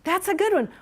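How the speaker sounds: bright, high praise; light up at a good answer; excited. Real, not acted. Teacher praises a student answer.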